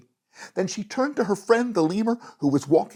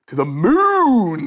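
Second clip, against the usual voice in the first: "To the moon" laughed out, loud and slow; a loud slow laughing call; 4 semitones higher, 7 dB louder, slower than usual. A narrator's reading. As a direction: laugh it out with glee. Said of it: "he laughed".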